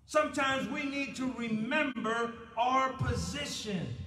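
fiery pulpit rebuke; preaching at full force. Acted. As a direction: hammer the rebuke home.

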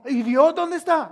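loud, high-pitched complaint; mock indignant demand. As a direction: cry it out like an aggrieved believer. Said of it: "Y después decimos".

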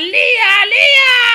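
high, loud, hammed-up holler; calling out. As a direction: holler the name, stretching the second one. Llama a gritos, fingiendo naturalidad. (llamada a gritos, aguda y exagerada)

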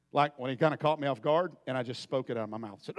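ordinary talk

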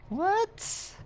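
very high-pitched incredulous yelp; squeaky, drawn-out disbelief; shocked squeak. Real, not acted. Startled by shotgun find in game.

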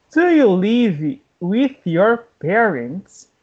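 slow, carefully spaced question; slow teaching model. Shown as a demonstration. English teacher modelling a question for learners, slowly. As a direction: stretch out "Do you live with your parents".

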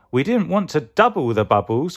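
high, mildly distressed wail; acted high cry of dismay. A narrator's reading. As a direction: protest in a high, upset voice. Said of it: "cried Cucumber".

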